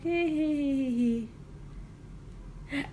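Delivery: mock nervous tittering; awkward giggle. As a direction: imitate your own awkward little laugh. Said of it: "I'm just, like, laughing".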